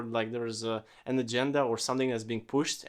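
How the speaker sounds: ordinary talk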